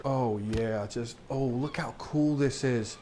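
low, soft marvelling; hushed awe. Real, not acted. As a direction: murmur it low, taken with the view. Marvelling at the game's look.

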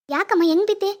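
loud, high worried question; alarmed. Acted. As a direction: urgently ask an injured mother. Son questions his fallen mother.

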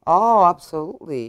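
normal talking voice

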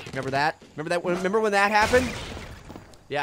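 usual speaking voice

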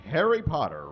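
ordinary talk, nothing marked

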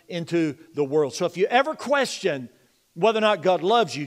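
loud, high-pitched pulpit preaching, driving each point; rising revival sermon voice; fervent preaching. Acted. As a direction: preach it full force to the congregation. Reading scripture with preaching force.

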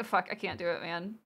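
normal talking voice

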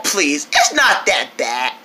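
ordinary talk, nothing marked

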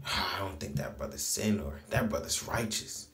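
low, hushed wondering voice; imagined onlooker's musing. Retold or imitated. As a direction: murmur it like an admiring onlooker. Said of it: "some brothers that think like that".

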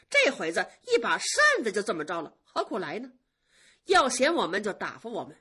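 biting, sneering retort to her master; cold sneer. Acted. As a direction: needle him with icy mockery. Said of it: "晴雯冷笑了一声".

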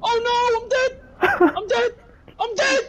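scared horror game streamer. Real, not acted.

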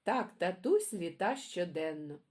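put-on lively sing-song poem reading for kids; reading poems to children. A narrator's reading. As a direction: read it like a bedtime rhyme for little ones. Reads children's poems aloud.

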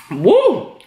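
coughing after swallowing wrong; choking cough, food down wrong pipe; swallowed wrong way. Real, not acted. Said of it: "Went down the wrong hole".

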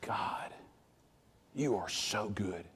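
whispered praise to God; whispered prayer. Retold or imitated. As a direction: breathe it out as a private prayer. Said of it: "I remember".

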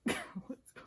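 ordinary talk

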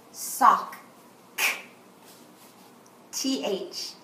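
teacher's phonics sound drill; phonics review. Shown as a demonstration. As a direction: isolate each digraph sound after its key word. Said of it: "Let's review".

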